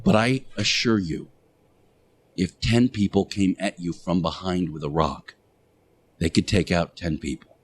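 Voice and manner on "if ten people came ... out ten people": normal talking voice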